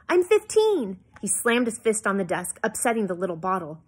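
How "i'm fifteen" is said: high, loud indignant insistence; raised teenage protest. A narrator's reading. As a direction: insist on it, voice shooting right up. Daughter argues she is old enough.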